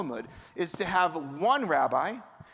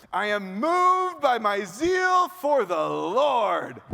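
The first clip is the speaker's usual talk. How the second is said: slow, loud, grand declaration, pitched higher; rabbi voice; 4 semitones higher, 6 dB louder, much slower than usual. Acted. Elijah declaring his zeal to God in booming rabbi voice. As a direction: proclaim it like a zealous old rabbi. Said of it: "I'm gonna do this in the gendered and the rabbi voice".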